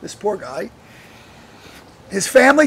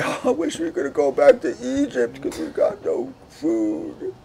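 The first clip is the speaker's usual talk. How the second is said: lightly whiny mock complainer, higher pitch; mocking whiny Israelites; 5 semitones higher than usual. Preacher mocks the grumbling Israelites, lightly whiny. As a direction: poke fun at grumbling people, lightly whiny. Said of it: "And they say".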